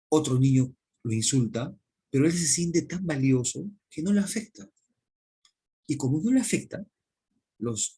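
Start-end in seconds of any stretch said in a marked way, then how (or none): none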